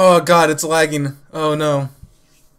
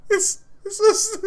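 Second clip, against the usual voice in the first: high words breaking into laughter; crying with laughter; 14 semitones higher, slower than usual. Real, not acted. Laugh-crying at the screen. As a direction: try to speak through laugh-tears. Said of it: "I'm actually crying a little bit".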